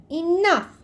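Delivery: high, sharp grandma shout; grandma yelling to end the fight. A narrator's reading. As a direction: stop the squabble with one word. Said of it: "Grandma shouted".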